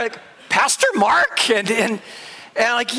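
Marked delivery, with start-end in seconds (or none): 0.5-1.5 high, drawn-out questioning disbelief; puzzled surprise. Retold or imitated. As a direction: imitate bewildered churchgoers spotting their pastor. Couple amazed to see pastor there.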